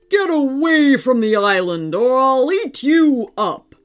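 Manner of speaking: roaring robot dinosaur. Acted. Robot dinosaur warns children off its island, roaring. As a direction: threaten them like a huge metal beast. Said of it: "roared the robot dinosaur".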